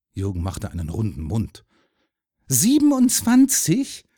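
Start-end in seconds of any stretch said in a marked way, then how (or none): 2.5-4.0 very high, slow, disbelieving echo; loud, drawn-out amazement; astonished question. A narrator's reading. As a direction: repeat it in wide-eyed wonder. Boy amazed at the number.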